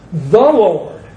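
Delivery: "The" leaned on hard before "Lord"; preacher's weighty emphasis on "The"; stress on THE. Retold or imitated. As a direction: punch the word "The". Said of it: "emphasize the word "the".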